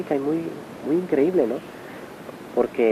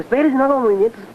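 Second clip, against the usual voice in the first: much higher, urgent begging to wait; alarmed plea; 10 semitones higher, 3 dB louder, faster than usual. Retold or imitated. Follower begging the master not to climb onto the stretcher, alarmed high plea. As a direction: stop him in a panic, pitched way up.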